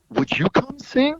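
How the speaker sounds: very high put-on voice; imitated tentative request. Caller asking him to sing on tour, imitated tentative request. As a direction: pitch it way up as a hopeful ask.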